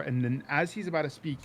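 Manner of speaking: ordinary delivery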